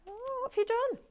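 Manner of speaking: very high-pitched, drawn-in mock alarm; playful mock scolding. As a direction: squeak it as a teasing question. Teasing a viewer about mischief.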